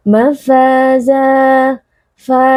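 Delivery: "Mafaza, fa" chanted slowly with long a vowels; lengthened vowel. Shown as a demonstration. Teacher demonstrates mad on "fa", chanting the a long. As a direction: hold the a of "fa" for two beats. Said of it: "dibaca panjang dua harakat".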